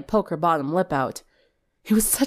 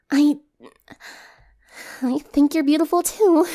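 put-on shy girl; 8 semitones higher than usual. Twilight shy confession.

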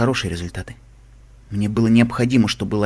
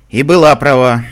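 louder, firmer voice; gaining strength; 8 dB louder than usual. A narrator's reading. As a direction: find some strength in the voice. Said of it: "обретя чуть больше силы в голосе".

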